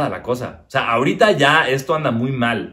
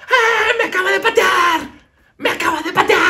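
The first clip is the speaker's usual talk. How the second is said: excited wife. Retold or imitated. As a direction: squeal like she just felt the kick. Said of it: "Toda emocionada".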